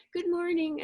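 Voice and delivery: put-on children answering. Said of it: "And they'll say".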